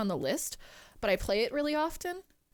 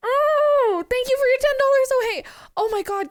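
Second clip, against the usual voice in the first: high, loud, excited gratitude; real giddy rush of thanks; squealed thanks; 10 semitones higher, 9 dB louder than usual. Real, not acted. Streamer to a ten-dollar donor, squealed thanks.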